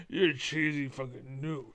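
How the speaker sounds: ordinary delivery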